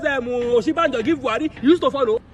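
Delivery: normal talking voice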